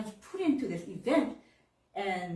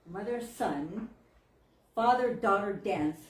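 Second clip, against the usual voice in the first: ordinary talk, nothing marked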